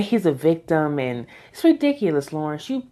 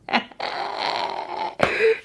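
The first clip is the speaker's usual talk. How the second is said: high, airy giggle; amused laugh; 3 dB quieter than usual. Real, not acted. Viewer laughing at the drawers joke, high giggle.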